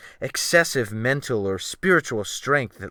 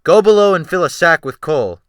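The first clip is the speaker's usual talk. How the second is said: barked order; 7 semitones higher, 11 dB louder than usual. A narrator's reading. Captain barks order.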